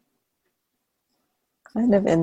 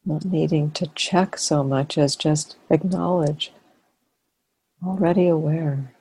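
guided meditation. Real, not acted. Teacher leading a sitting to relax and notice awareness, guided meditation. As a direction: invite them gently into awareness, phrase by phrase.

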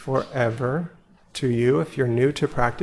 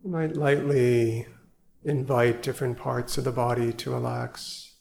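guided meditation; 4 dB quieter than usual. Acted. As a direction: ease them into stillness, gently and unhurried. Leads evening sit meditation.

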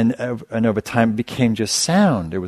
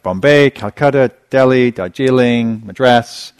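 louder, slow, higher calling out city names; announcer calling towns; 4 semitones higher, 7 dB louder, slower than usual. Retold or imitated. Teacher imitating the taped bus ad, louder slow calling. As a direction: call the destinations like a bus tout. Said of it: "then it would".

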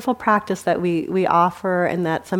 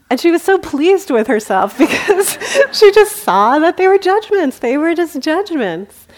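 high, loud laughing retelling; bubbling with laughter, voice pitched up; laughing delight; 10 semitones higher, 7 dB louder than usual. Real, not acted. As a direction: tell it through delighted laughter. Laughing while telling the story.

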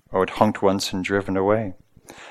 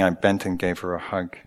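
ordinary delivery

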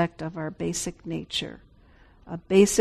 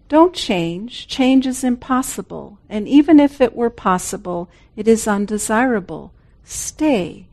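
reading a quote; 2 semitones higher, 7 dB louder than usual. Acted.

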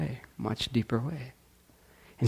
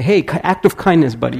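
pushy jokey; 4 semitones higher, 6 dB louder than usual. Pretend. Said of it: "I could inflict my song onto you and say".

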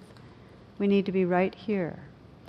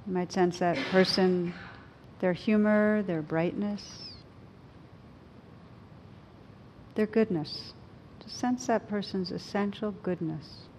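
guided meditation. Acted. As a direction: gently guide them inward.

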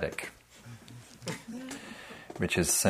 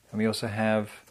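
usual speaking voice

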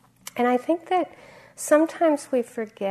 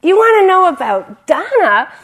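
high, loud, gleeful outburst; animated monk; 11 semitones higher, 16 dB louder than usual. Retold or imitated. Laughing monk answers manager's question about generosity, animated high shout. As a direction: cry it out with uproarious delight. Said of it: "he's just so animated".

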